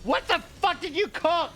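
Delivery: put-on high, outraged comic German accent; comical German accent. Sprinkle demanding what was cooked, outraged German accent. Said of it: "Why is Sprinkle German?".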